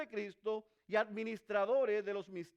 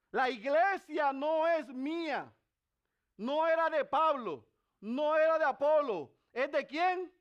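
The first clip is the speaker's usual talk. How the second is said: rising pulpit fervor; fervent preaching; 5 semitones higher, 5 dB louder than usual. Real, not acted. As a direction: build up and press each claim. Preaching at full force.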